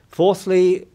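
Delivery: normal talking voice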